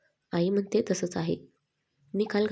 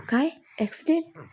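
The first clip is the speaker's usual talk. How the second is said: high, rising cry of shock; as if the floor dropped away; shaken disbelief; 7 semitones higher than usual. A narrator's reading. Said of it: "तिने हादरल्यासारखं विचारलं". (हादरलेल्या, उंच स्वरात अविश्वासाने विचारणे)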